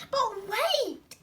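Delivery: loud, urgent exclaim. A narrator's reading. Squirrel stops the bird leaving, urgent exclaim. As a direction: call him back before he goes. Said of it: "exclaimed Squirrel".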